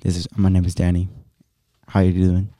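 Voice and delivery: low, monotone imitation of a friend; Danny's monotone voice. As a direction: drone it low in one tone. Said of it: "you have a monotone voice, like".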